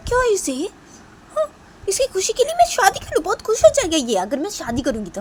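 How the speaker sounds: very high, loud indignant complaint; angry rant. Acted. Kritika fuming about her brother alone. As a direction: rant furiously to herself.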